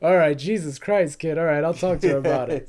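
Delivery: loud, fed-up priest caving in; weary priest giving up; exasperated priest. Retold or imitated. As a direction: throw up your hands and give in. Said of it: "High Sparrow's like".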